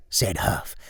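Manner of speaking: ordinary talk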